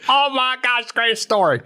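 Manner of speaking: high-pitched gleeful buddy voice; Carl. Retold or imitated. Friend relishing a great story, high and gleeful. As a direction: imitate an Oklahoman eager to tell. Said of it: "No, Carl talks".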